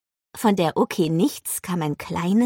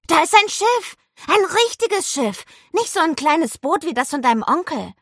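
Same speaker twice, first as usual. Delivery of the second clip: very high, loud excited chatter; 11 semitones higher, 4 dB louder than usual. A narrator's reading. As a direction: burst out with an exciting discovery. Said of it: "rief er aufgeregt". (aufgeregt und hoch gerufen)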